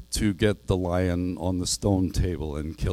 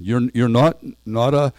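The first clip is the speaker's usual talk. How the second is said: shaky voice; 3 semitones higher, 6 dB louder than usual. A narrator's reading. Susan fears he is a ghost, shaky and halting. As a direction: stammer it, afraid to finish. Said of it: "asked Susan in a shaky voice".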